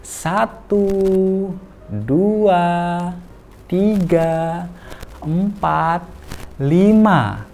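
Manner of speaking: counting slowly, high and drawn out. Shown as a demonstration. Parent counting down to the child, slow and high. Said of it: "kita hitung pelan-pelan".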